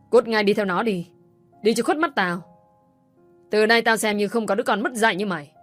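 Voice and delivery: furious shout. Acted. Father throwing his daughter out of the house, shouting. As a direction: explode at her, driving her out. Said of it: "ông đã mắng ngay".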